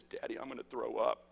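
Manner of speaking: quiet, low imitation of a sick child; queasy child. Daughter warns dad at the register, quietly queasy. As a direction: say it small and soft, as a queasy little girl.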